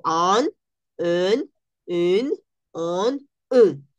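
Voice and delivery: "an", "en", "un", "on" nasales y separadas; vocales nasales. Shown as a demonstration. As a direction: marca cada vocal nasal francesa. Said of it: "es pronunciación nasal". (vocales nasales del francés)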